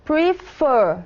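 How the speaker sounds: "Prefer" with its second syllable stressed; stress on last syllable. Shown as a demonstration. Teacher proving which syllable is stronger, "Prefer" stressed on the last. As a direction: lean clearly on the end of "Prefer". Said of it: "Vemos claramente que a última sílaba".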